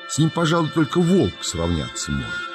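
plain reading voice

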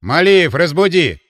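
loud, curt order; commanding. A narrator's reading. Быков sends his man to wake the sleeper, commanding. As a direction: command it loudly, as an officer. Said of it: "скомандовал Быков".

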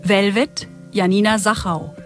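ordinary delivery

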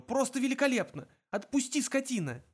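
very high, indignant outburst; high, strained sarcasm; choked protest. A narrator's reading. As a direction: protest frantically while being dragged. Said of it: "хрипел Артур".

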